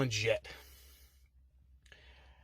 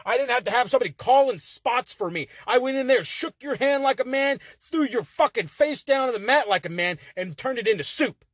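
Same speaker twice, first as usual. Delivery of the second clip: fired up; 6 semitones higher than usual. Real, not acted. Fired-up rant at rival.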